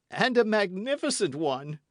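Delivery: high, loud cry; host crying out. Acted. Host cries out to Athos, loud and high. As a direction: burst in eagerly, loud. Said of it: "cried the host".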